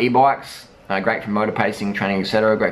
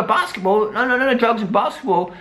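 high, fast mocking voice of a naive fan; high mocking parody of a basketball fan; mocking denier; 9 semitones higher than usual. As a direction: parody the people who deny it. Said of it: "People go".

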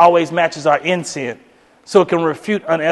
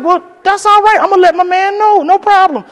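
much higher, lightly voiced girlfriend; polite girlfriend; 12 semitones higher, 4 dB louder than usual. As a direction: brush it off sweetly. Girlfriend replies.

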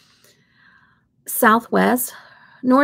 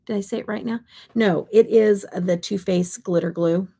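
normal talking voice

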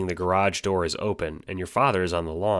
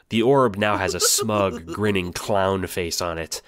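normal talking voice

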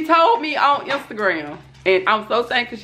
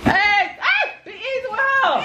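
laughing call; 12 semitones higher than usual. Real, not acted. Laughing at kids grabbing box.

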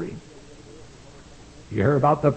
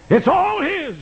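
exclaiming; 14 semitones higher, slower than usual. Acted. Preacher's exclamation.

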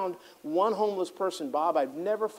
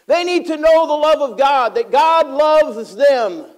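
high, loud fervent preacher's cry; preaching at full force; 9 semitones higher, 11 dB louder than usual. Acted. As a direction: bring it home to the congregation, rising and loud.

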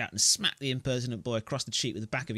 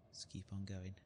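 normal talking voice

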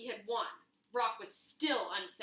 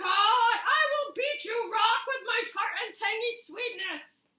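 much higher, louder slow cartoon fruit threat; squeaky apricot; 10 semitones higher, 9 dB louder, slower than usual. Apricot boasting at Rock before the fight, squeaky and loud. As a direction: stretch the boast out like a silly villain.

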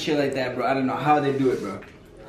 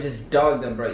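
ordinary talk

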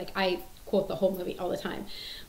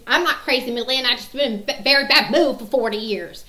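loud, higher, cantankerous impression; mean old woman; 4 semitones higher, 7 dB louder than usual. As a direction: act out a grouchy old lady protesting. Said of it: "she's just a mean old woman".

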